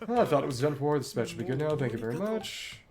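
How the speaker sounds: ordinary talk, nothing marked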